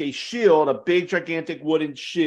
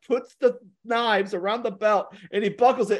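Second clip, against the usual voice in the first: ordinary delivery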